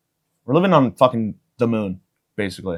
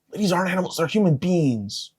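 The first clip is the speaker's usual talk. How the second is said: higher and softer, almost mock-sincere; high soft repeat; 5 semitones higher, 7 dB quieter than usual. Shown as a demonstration. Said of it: "repeats the line in another voice".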